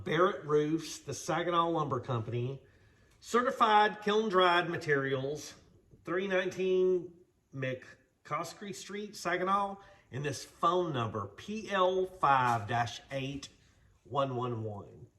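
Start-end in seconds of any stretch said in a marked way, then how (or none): none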